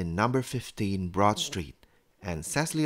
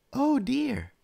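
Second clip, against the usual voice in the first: high-pitched mum voice; worried mother. A narrator's reading. Mummy to Daddy over the mistaken invitation, concerned. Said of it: "said Mummy to Daddy".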